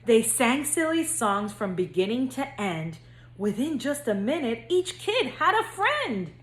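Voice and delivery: lively singsong storybook reading; reading to children. A narrator's reading. Counselor reading to future kindergartners, bouncy storybook narration. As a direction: read it like bedtime story time for little kids. Reads picture book to kindergartners.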